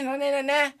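ordinary delivery

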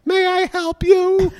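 very high, slow clown-speaker voice; weird voice. Retold or imitated. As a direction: drag it out high and weird, like a drive-through clown. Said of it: "someone with a weird voice go".

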